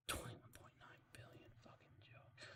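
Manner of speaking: breathy whisper under the breath; whispered aside. Real, not acted. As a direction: hiss the number as a private aside. Whispered aside, then repeated plainly.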